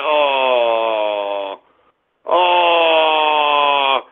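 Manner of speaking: long, low, sinking "oh"; drawn-out mocking groan; mock disappointed moan. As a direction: drag out a moan of fake disappointment. Feigned disappointment at no call back.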